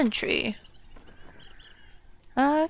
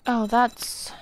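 ordinary delivery

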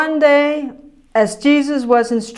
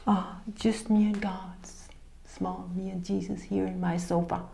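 mock timid piety; 5 semitones lower, 15 dB quieter than usual. Mocking timid private Christians.

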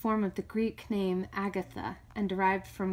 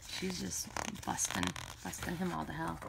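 whisper; 3 semitones lower, 7 dB quieter than usual. Real, not acted. Describing card, voice drops away.

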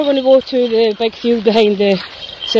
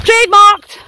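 louder, higher Trademarked; proud announcement; 9 semitones higher, 6 dB louder, slower than usual. Acted. Dog walker boasting about her trademark in a proud, louder voice. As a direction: stamp the word proudly.

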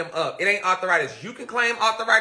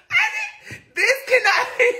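much higher, half laughing, half crying; laugh-crying disbelief; 3 dB louder than usual. Retold or imitated. Her thumb won't move, she laugh-cries in disbelief. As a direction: wail it while cracking up.

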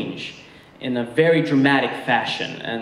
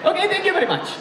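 put-on very high, accented, giving up; exasperated Egyptian mom; faster than usual. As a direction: mom throwing up her hands, laughing.

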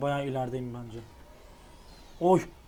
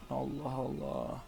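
slow, soft drawn-out Allah Allah; baffled sigh; 7 dB quieter, much slower than usual. Real, not acted. Gamer quietly baffled at the screen, slow and soft. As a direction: mutter Allah Allah slowly to yourself in disbelief.

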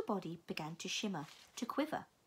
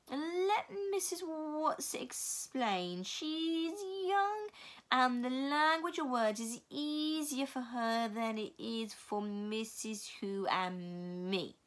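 slow eerie voice; 3 semitones higher, much slower than usual. Acted. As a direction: draw every word out, ghostly. Mrs Which speaking.

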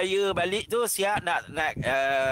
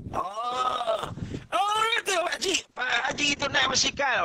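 high jeering shout; mocking classmate; 6 semitones higher than usual. Classmate mocking the speaker's father on his bicycle, jeering shout.

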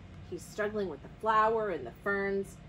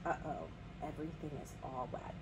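low, soft uh-oh; hushed dismay; 6 semitones lower, 11 dB quieter than usual. A narrator's reading. Storyteller sees everything got wet, hushed dismay. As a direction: quietly take in the damage.

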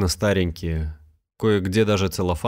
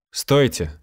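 higher, louder call after her; 6 semitones higher, 4 dB louder than usual. A narrator's reading. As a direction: shout to stop her leaving. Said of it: "закричал он".

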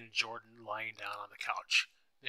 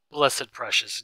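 put-on loud, cooing mother to a little child; lightly voiced baby talk, pushed louder; 9 dB louder, slower than usual. A narrator's reading. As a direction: gush over a toddler in mild baby talk. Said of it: "in a baby talk voice".

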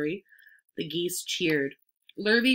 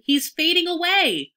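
high, loud wail of alarm; alarmed scream; 11 semitones higher, 7 dB louder than usual. A narrator's reading. Fern watches the pig collapse, alarmed scream. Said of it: "screamed Fern".

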